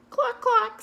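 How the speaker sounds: very high, loud cluck cluck; chicken clucking. Acted.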